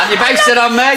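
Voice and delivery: high, laughing mock indignation; mock offence. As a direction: act affronted while amused. Host feigns offence at guest.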